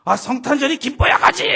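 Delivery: 높게 내지르는 반항; 아빠에게 빽 소리치듯 높고 크게; 대드는 딸. Retold or imitated. (소리치며 대드는 말투)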